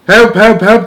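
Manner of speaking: high, loud cries for help; hammed-up screaming for help; panicked scream. A narrator's reading. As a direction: cry out in comic terror. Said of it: "the man was screaming".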